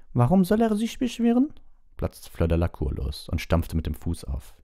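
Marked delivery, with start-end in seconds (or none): 0.1-1.5 put-on very high, loud indignant girl voice; Fleur's outburst. A narrator's reading. Fleur protests to the teachers, high and indignant. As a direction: burst out high and offended.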